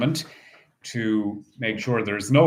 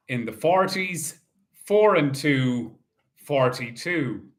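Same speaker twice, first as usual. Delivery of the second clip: bingo caller. Acted. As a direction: call each ball out steadily. Calls bingo numbers.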